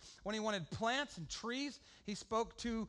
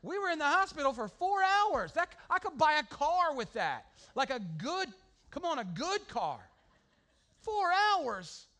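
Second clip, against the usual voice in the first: loud, high, incredulous rant; comic outrage; 6 semitones higher, 7 dB louder than usual. Retold or imitated. Pastor venting to the congregation about the bill, loud comic outrage. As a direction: belt it out like the bill is a personal insult.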